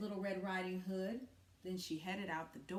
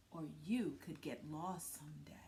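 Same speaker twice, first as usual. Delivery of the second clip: warning whisper; 6 semitones lower, 6 dB quieter than usual. Acted. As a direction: drop to a hushed warning.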